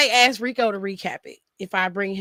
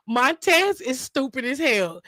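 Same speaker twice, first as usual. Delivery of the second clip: normal talking voice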